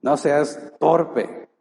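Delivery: slow "No seas torpe", stress on "torpe"; a fatherly correction; firm loving rebuke. Acted. Preacher telling the congregation, slow and firm. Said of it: "Es una muestra de amor".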